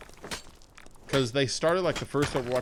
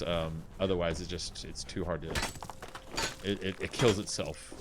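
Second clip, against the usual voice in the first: ordinary talk, nothing marked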